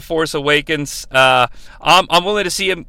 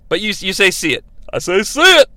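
ordinary talk, nothing marked

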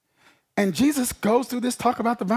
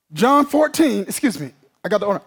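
ordinary talk, nothing marked

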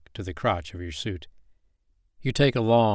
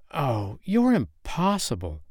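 slow, higher, fed-up sigh of a line; exasperated; 5 semitones higher, slower than usual. Acted. Woman gives up on the stubborn stranger, exasperated. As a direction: throw up your hands at him.

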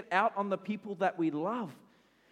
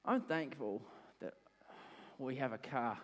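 softer, grudging thanks through clenched teeth; grudging thanks through gritted teeth; 4 semitones lower, 8 dB quieter than usual. Retold or imitated. I grit my teeth.

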